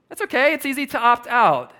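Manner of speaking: sarcastic shrug of a line; mock breezy voice. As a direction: say it like it's no big deal, mocking. Mocking the culture's easy excuses.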